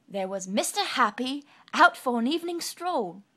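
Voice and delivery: loud, lively storybook voice; bouncy upbeat narration; bright cheerful reading. A narrator's reading. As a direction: read it with big cheerful energy.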